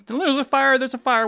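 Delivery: urgent call. Retold or imitated. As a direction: report the fire in a hurry.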